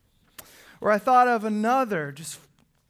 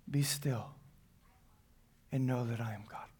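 much softer, lower and hushed; gentle hushed echo of the verse; soft repeat; 6 semitones lower, 10 dB quieter than usual. Acted. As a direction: let it sink in quietly. Repeated softly after reading.

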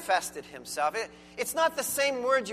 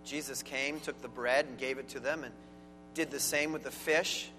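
lower, measured reading of scripture; 7 semitones lower, 5 dB quieter than usual. Acted. Pastor reading the Gospel aloud to the church, solemn reading. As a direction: read it out as the holy word.

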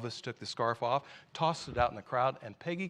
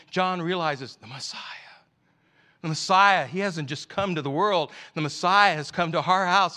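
rising, loud, fervent sermon delivery; impassioned preaching; 6 semitones higher, 9 dB louder than usual. Acted. Preacher urging the congregation toward desire, in full impassioned preaching. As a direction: preach it with building urgency. Preacher acting out John's joy.

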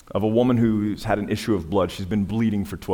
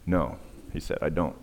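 quiet refusal; 5 semitones lower, 8 dB quieter than usual. Retold or imitated. Dying man refuses comfort.

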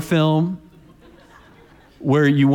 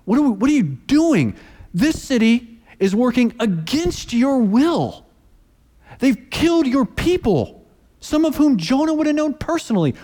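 Jonah protesting, voice pitched way up; exasperated Jonah; 10 semitones higher than usual. Acted. Preacher voicing Jonah complaining to God, high and exasperated. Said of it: "Jonah looks at this and he's like".

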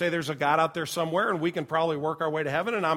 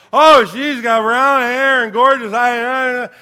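higher, much louder, very slow groggy rave; loud dazed gushing; 7 semitones higher, 14 dB louder, much slower than usual. Retold or imitated. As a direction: drawl it out loud like a drugged kid. Drugged son gushing about nurse.

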